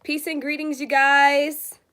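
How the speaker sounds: ordinary talk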